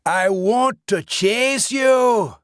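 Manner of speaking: a monster delighting in the hunt; gleeful ghost shout. A narrator's reading. Dead man shouts his wish to chase Lance.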